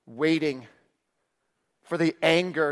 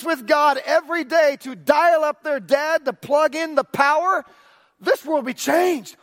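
preaching at full force; 6 semitones higher than usual. Acted. Pastor rallying his church toward revival, preaching hard. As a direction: lift the room with a vision.

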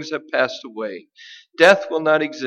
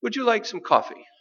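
higher, lightly voiced wife offering coffee; a sweet hinting question pitched up high; wife hinting to husband, light higher voice; wife's hint; 8 semitones higher, faster than usual. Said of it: "the wife turns to the husband and she says".

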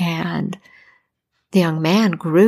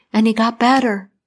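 plain reading voice

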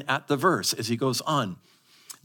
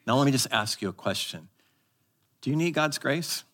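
ordinary delivery

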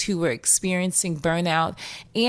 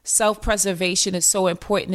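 ordinary talk

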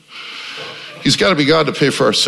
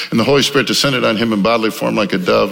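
usual speaking voice

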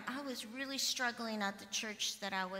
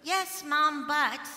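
louder, higher retold objection; loud protest; 4 semitones higher, 9 dB louder, slower than usual. Pastor retells protesting to her mom, loud. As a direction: push back hard on mom.

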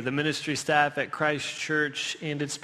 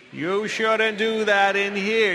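slow, drawn-out scold, louder; hammed-up churchy reproach; church voice; 3 semitones higher, 4 dB louder, much slower than usual. Shown as a demonstration. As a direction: wag a finger and scold it. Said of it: "in a church voice".

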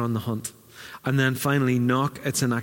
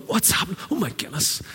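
surprised; 6 semitones higher than usual. Retold or imitated. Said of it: "you don't hear Philip going".

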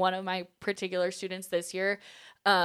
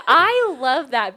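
higher, much louder, slow gush; excited squeal; 6 semitones higher, 13 dB louder, much slower than usual. Retold or imitated. Friend loving a relatable quote, excited and slow. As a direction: gush with delight.

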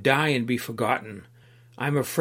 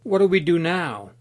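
higher, louder call for help; a mild, stuck-in-the-swamp cry to the companion; 5 semitones higher, 4 dB louder than usual. Acted. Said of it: "Tolerant cried out".

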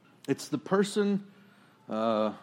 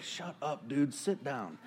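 much softer, laughing brush-off; muttered put-down; 7 dB quieter than usual. Retold or imitated. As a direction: hush him down with a laugh. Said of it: "it'd be like".

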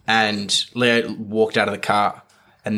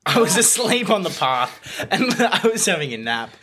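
laughing; 8 semitones higher than usual. Real, not acted. Laughing at own story.

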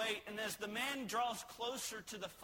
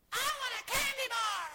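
put-on high, loud bratty child's shout; whiny child demanding candy; 6 dB louder than usual. Child in grocery cart yelling at parent for candy, high whiny shout. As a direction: shout it like a kid begging at the checkout.

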